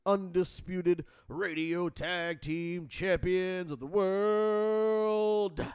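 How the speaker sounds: booming, drawn-out ring announcer; ringmaster. Pretend. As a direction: introduce the champions like a circus ringmaster.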